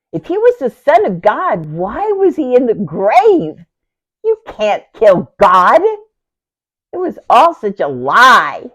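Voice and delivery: high, loud, drawn-out cry of outrage; anguished outburst. Acted. Sister rages that her brother's death proves a lie, anguished. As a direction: rage against the death, stretching the words.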